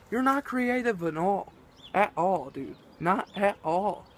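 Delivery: ordinary talk, nothing marked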